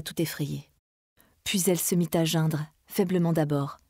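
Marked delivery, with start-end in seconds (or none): none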